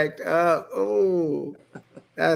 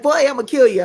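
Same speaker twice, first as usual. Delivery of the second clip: slightly loud, higher-pitched mock threat; raised-voice retold threat; 6 semitones higher, 3 dB louder than usual. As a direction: play the offended guy lightly, pitched up. Acted threat from people being teased.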